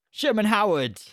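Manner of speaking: loud excited call of the name; loud, high exclamation of praise; shout out. Real, not acted. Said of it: "special shout out to".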